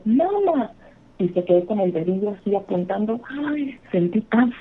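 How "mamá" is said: put-on a much higher, falling mamá, like a child pointing at something; frightened little boy. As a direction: mildly imitate the boy calling mamá. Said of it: "y dijo".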